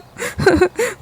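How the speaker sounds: a high breathy sob. Acted. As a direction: break into a sob. Son crying over mother. (ऊँची सिसकी)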